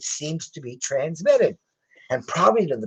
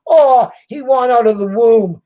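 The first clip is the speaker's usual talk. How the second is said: put-on high, loud, whiny baby voice; mock baby talk; 5 semitones higher, 6 dB louder than usual. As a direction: taunt like someone imitating a baby. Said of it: "taunts Trump with baby talk".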